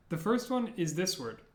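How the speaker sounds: ordinary delivery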